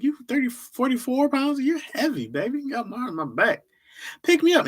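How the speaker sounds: put-on high whiny kid asking to be picked up; daughter voice. Daughter begs her dad to pick her up, high and pleading. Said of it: "I'm like".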